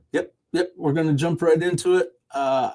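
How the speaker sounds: ordinary delivery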